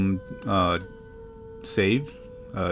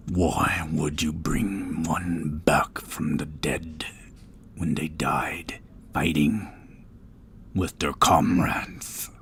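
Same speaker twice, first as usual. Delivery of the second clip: deep, slow, whispery statue voice; talking statue; 3 semitones lower, slower than usual. Acted. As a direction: speak slow and low like living stone. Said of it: "In a deep voice that almost seems to permeate the entire area".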